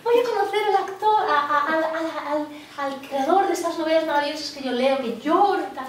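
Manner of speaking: a blonde fan squealing to meet the writer; hysterical blonde fan. Retold or imitated. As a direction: gush like a starstruck hysterical fan. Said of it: "rubia, histérica".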